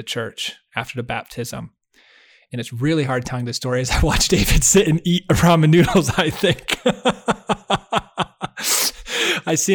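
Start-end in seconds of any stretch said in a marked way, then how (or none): 2.5-9.7 breaking into laughter mid-story; losing composure and laughing. Real, not acted. Telling David about the ramen, cracking up. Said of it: "I'm trying to be all serious right now".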